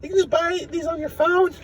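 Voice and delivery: very high teasing Morty voice; mock Rick in Morty's voice; Morty impression. Morty teasing Rick about phone stocks, very high. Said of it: "from be like".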